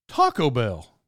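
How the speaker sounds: "Taco" carries the weight, "Bell" drops away; stress on Taco. Shown as a demonstration. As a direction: lean on "Taco", let "Bell" fall. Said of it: "difference between people who say".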